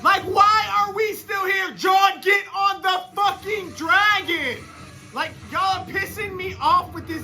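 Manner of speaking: high, loud, slow drawn-out yell; real enraged shouting at the TV; furious shout. Real, not acted. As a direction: scream at the screen to hurry up. Screaming at characters on TV.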